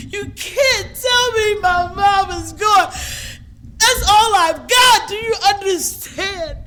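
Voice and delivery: high, loud wail of refusal; anguished denial. Retold or imitated. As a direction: cry out in grief, refusing to believe it. Reliving denial of mother's death.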